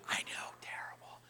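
barely audible whisper; hushed, confiding whisper; whispered aside. Real, not acted. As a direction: whisper it like a guilty admission. Whispered aside after the confession.

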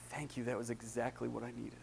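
soft retold thanks from the cashier; hushed grateful thanks. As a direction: say it gently, as if close to tears. Crying cashier thanks friend.

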